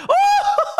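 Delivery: high yelp, then a breathless silent laugh; shocked laugh. Real, not acted. Fan watching the rival team attack, yelping then laughing. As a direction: yelp and dissolve into airless laughter. Watching opponents push for a third.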